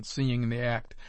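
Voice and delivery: ordinary delivery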